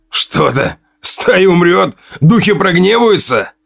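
mocking her until the voice breaks; voice cracking to a squeal. A narrator's reading. Hunter mocking Una's warning, voice breaking into a squeal. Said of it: "Голос его срывался на визг".

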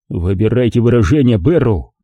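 ordinary delivery, nothing marked

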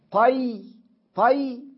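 طي alone as "tay", high and loud; isolated first syllable. Shown as a demonstration. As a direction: punch out طي, open a closing on a still y. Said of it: "طاء مفتوحة وياء ساكنة".

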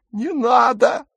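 put-on very high, frightened girl's plea; terrified high-pitched protest; gasped plea. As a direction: beg in a thin high voice. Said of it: "выдохнула Дагмар".

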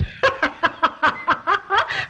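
long, very high giggle; high cackling laugh. Acted. Son teasing his mother about a surprise, high giggling laugh. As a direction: burst into a run of high laughter.

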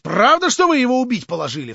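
high, loud outburst; exclaimed. A narrator's reading. Stavrogin crying out at Verkhovensky about Shatov. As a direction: cry out in shocked alarm. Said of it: "вскричал Николай Всеволодович".

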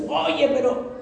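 high, soft, stammering protest; trailing off, caught out; cornered Peter. Retold or imitated. Peter confronted a third time, soft high stammer.

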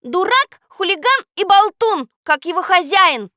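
very high, loud angry yell; outshouting. A narrator's reading. Girl outshouts the parrot with insults, furious shout. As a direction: hurl the insults at full voice. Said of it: "ещё громче крикнула Катя".

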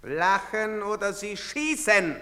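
ordinary delivery

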